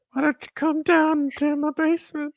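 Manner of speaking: creepy man impression. Creepy parody reply to Tiana.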